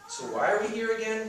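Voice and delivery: put-on high, impatient teen voice; fidgety buddy. Vinny asks his friend why they came, high and impatient. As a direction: wonder aloud why you came.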